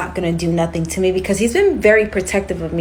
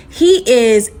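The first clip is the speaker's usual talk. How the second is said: ordinary delivery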